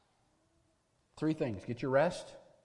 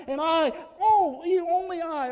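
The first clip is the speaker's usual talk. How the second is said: desperate cry; 11 semitones higher, 6 dB louder than usual. Acted. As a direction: wail it out like a broken prophet. Elijah's despair peaks.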